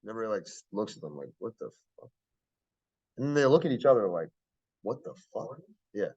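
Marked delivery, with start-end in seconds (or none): 1.4-2.1 very soft, under-the-breath disbelief; hushed bewilderment. Retold or imitated. Baffled students reacting to the chant, hushed mimicry. Puzzled students exchanging looks. 4.8-5.6 very soft mimicked aside; hushed bewilderment. Leaders look at each other, hushed disbelief.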